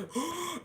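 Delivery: high, soft startled intake of breath; sharp gasp. Retold or imitated. Reacting as the teacher sees, a startled gasp. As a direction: gasp as if caught.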